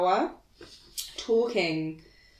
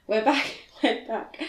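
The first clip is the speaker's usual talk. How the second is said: laughing; 4 semitones higher than usual. Real, not acted.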